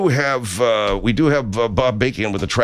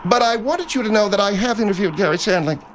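higher-pitched, self-important apology; aggrieved co-host Margaret; 7 semitones higher than usual. Acted. Margaret asking to be let back in, higher prim apology. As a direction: apologize while insisting on your own credit.

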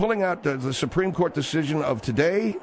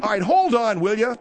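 raised, higher, exasperated; loud; 9 semitones higher, 4 dB louder than usual. Real, not acted. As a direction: cut them off loudly. Host breaks up fight.

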